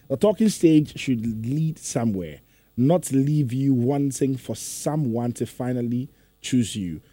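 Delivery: slow, measured read-out; careful reading. Real, not acted. Host rereads a listener's comment properly, slow and careful. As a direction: read it out properly, taking your time. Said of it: "okay, let me read it properly".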